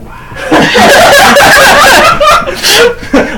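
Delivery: high shrieking laugh; cracking up loudly; loud burst of laughter. Real, not acted.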